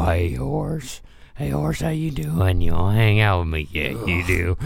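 low, soft coaxing of a horse; deep murmured animal talk; sweet voice for animals. Retold or imitated. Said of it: "that's my sweet voice".

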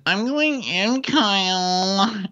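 high-pitched mock whine; exaggerated high protest; Amanda. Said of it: "And she's like".

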